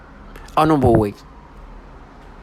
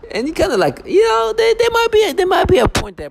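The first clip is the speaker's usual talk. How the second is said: very high squeaky falsetto, louder; mocking falsetto; 3 dB louder than usual. Mocking people who call them satanic, in a high squeaky falsetto. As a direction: squeak it out like a silly parody.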